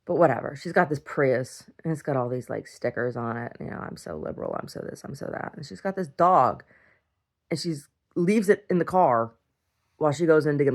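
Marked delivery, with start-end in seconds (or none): 3.8-5.5 soft, low mocking aside; mocking quote. Mocking the Prius owner's stickers, quiet dismissive imitation. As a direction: toss it off quietly in mockery.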